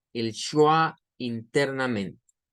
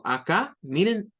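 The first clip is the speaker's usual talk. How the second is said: usual speaking voice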